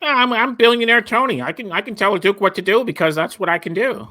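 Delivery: high, cocky rich guy voice; smug wealthy tycoon. Retold or imitated. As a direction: play the smug billionaire boss. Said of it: "your favorite Billionaire Tony voice".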